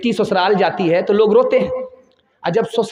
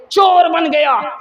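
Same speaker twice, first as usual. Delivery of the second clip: shouted; 9 semitones higher, 4 dB louder, slower than usual. Retold or imitated. Son at the gallows cries that he became a thief, shouting. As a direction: shout CHOR high and loud. Climax of son's accusation.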